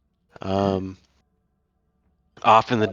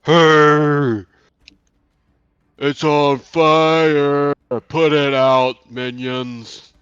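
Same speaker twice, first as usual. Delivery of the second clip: loud, higher, slow drawn-out bellow; booming voice; 5 semitones higher, 5 dB louder, much slower than usual. Acted. Unseen boss orders minions to douse fire, booming voice. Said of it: "a booming voice".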